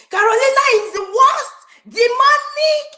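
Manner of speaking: high, hammed-up wailing complaint, slowing on Demonic; mock pained whine. Mocking a rival's pained complaint to the meeting, high mock wail. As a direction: mimic a crybaby moaning about his pain. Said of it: "Look at the pain in his voice".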